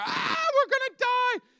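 high, loud panicked cry; terrified disciples yelling at sleeping Jesus; panicked shout. Retold or imitated. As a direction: yell it like the boat is sinking.

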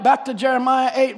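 ordinary talk

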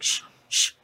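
sharp unvoiced "shh", twice; retold shushing. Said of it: "he yells and I'm like".

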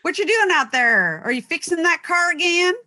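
Southern wife calling her husband in from the porch; Southern accent. Pretend. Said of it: "Southern show".

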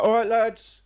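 bright, cheery guard greeting, high and loud; guard's greeting. Retold or imitated. Officer greeting new inmates, cheery and loud. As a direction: call out like a chummy prison officer. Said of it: "he's like".

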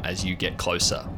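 ordinary talk, nothing marked

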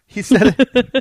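high, slow, laughing through the words. Real, not acted. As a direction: laugh it out in disbelief. Laughing repeat of the news.

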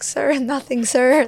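high, laughing sir-nothing-sir to a drill instructor; nervous recruit answer. Retold or imitated. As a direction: stammer it out like a scared recruit.

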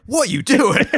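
high, loud disbelieving shriek; incredulous high squeal. Retold or imitated. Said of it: "And everyone's like".

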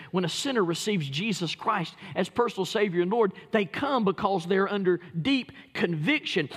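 normal talking voice

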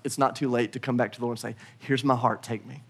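low, soft prayerful close; hushed surrender. Acted. Preacher inviting church back to the Lord, hushed. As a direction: let it sink into a quiet prayer.

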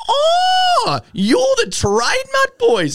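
very high, loud squeal of recognition, slowed; starstruck shriek. Retold or imitated. Gate woman recognising the band's mates, excited squeal. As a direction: squeal like a thrilled fan. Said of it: "She goes".

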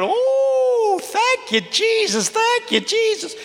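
very high, sustained grandmother praising Jesus; high praise cry. Retold or imitated. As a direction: sing out thanks like an elated grandma. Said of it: "Somebody else will say".

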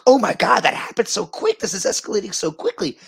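high, loud imitation of amazed coworkers; freaking out. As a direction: gush it out like an astonished crowd. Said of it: "freaking out".

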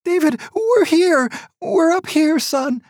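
very high, loud call; mother calls down to her son, calling out. A narrator's reading.

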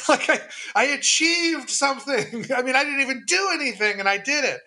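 high, laughing and slowed. Real, not acted. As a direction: giggle through it, still giddy. Laughing at own excitement.

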